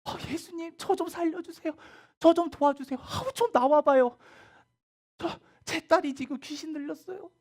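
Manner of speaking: high, loud, desperate pleading; mother shouting high and loud, begging Jesus to save her daughter; crying out at full voice. Acted. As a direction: call out with everything you have for your child. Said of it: "목 놓아서 예수님을 불렀겠지".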